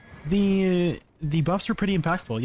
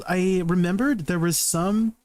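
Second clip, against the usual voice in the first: usual speaking voice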